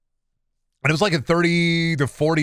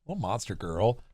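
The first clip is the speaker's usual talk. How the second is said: cooing at cat; 6 semitones lower, 10 dB quieter than usual. Real, not acted.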